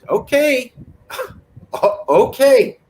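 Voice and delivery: high, careful placating okay. Retold or imitated. Talking to stepdad after a bad golf game, placating and high. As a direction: soothe a grumpy stepdad. Tiptoeing around angry stepdad.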